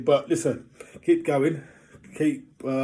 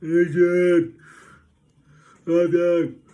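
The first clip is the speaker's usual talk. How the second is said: loud, higher Rocky-style yell of Adrian; a hammed-up Sylvester Stallone shout, loud and higher; Stallone impression; 6 semitones higher, 11 dB louder, much slower than usual. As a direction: bellow the name like Rocky. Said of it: "Sylvester Stallone".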